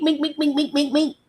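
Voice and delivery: high-pitched rapid-fire mink sounds; imitated punch noises. Commentator imitating a fighter's jabs, high staccato noises. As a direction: rattle off high little jab noises.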